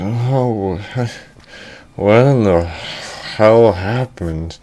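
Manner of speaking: slow, drawn-out mock grogginess; groggy waking damsel. Damsel waking up after fainting, slow groggy voice. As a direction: come round slowly from a faint.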